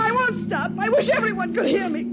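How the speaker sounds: high-pitched hysterical defiance; defiant shouting. Acted. Spurned woman defying the man who hushes her, shrieked anger. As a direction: refuse to be hushed, voice climbing. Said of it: "Stop shouting".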